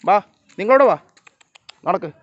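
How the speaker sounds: loud, high summoning call; calling animals over. Real, not acted.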